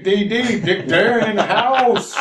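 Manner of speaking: high, loud hype man shout. Pretend. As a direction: hype up the crowd like an MC. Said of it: "I'm the hype man".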